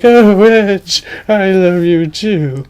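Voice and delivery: very high swooning princess. Acted. Princess Pattycake answering Edge, high swooning voice. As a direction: gush it like a lovestruck princess.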